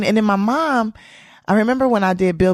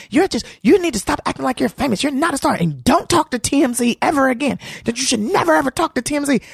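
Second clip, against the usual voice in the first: higher-pitched angry lecture imitation; going off; 8 semitones higher than usual. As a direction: act out a comic laying down rules. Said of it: "he was going off on me".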